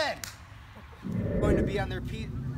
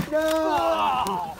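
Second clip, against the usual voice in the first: anguished shout; 7 dB louder than usual. Acted. Teammate falling.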